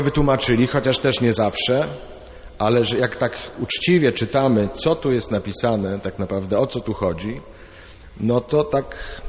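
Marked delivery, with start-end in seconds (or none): none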